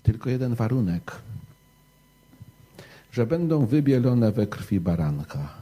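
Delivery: ordinary delivery, nothing marked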